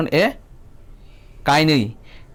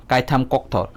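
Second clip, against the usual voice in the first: ordinary talk, nothing marked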